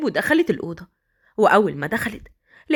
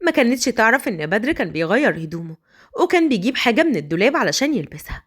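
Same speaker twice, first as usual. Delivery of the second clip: ordinary talk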